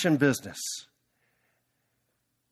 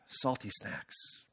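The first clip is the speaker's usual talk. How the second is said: whispered, slow, all air and no voice; deflated sigh; 5 semitones lower, 15 dB quieter, much slower than usual. Acted. The salty snack buyer, giving up, in a slow whisper. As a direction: breathe it out like it's hopeless.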